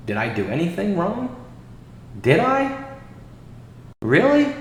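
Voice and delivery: questions swooping way up high; mock disbelief. Streamer asks viewers if he did wrong, in mock disbelief. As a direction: feign innocence with steep rising questions.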